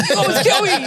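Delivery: very high mock child squeal; hammed-up child's accusation; scared little kid. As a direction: squeak it like a terrified eight-year-old, joking. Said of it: "He's like".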